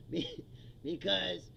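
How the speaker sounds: ordinary delivery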